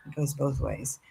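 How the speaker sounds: breathy whispered echo; whispering. Real, not acted. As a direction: whisper it back to yourself, moved. Host repeats phrase softly.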